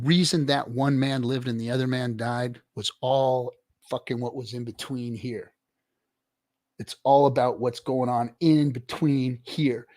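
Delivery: ordinary talk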